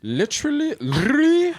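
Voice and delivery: squeaky high incredulous literally; mocking high voice. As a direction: mimic a whiny kid.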